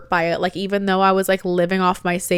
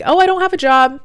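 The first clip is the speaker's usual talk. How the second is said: breezy; 8 semitones higher, 6 dB louder than usual. Retold or imitated. Breezy announcement to friends.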